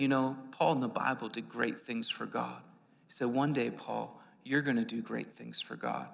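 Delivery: soft, low, gentle; quiet retelling. Retold or imitated. Stranger outside the bar gently encouraging young Paul, quietly. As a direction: speak it like a quiet blessing.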